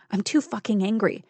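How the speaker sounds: ordinary delivery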